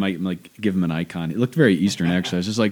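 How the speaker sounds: ordinary delivery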